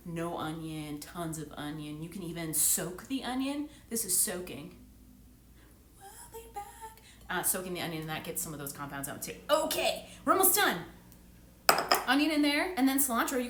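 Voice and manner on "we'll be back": whispered, drawn-out; whispered sign-off. Real, not acted. Telling viewers the onion is soaking, whispered. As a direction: whisper it like a secret.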